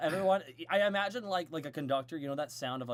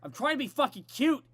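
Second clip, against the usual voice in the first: mock annoyed; 6 semitones higher, 8 dB louder than usual. Real, not acted.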